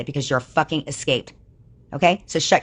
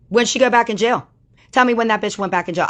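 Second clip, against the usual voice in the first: sharp, loud, higher-pitched questions; angry demand; 7 semitones higher, 4 dB louder than usual. Real, not acted. Ranting at chat about the jailed woman, angry and loud. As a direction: demand answers, heated and loud.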